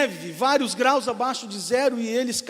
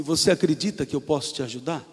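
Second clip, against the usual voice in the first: lower, calm divine voice; voice of God; 8 semitones lower than usual. Acted. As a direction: answer from above, steady and low. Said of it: "ele ouve uma voz que diz assim".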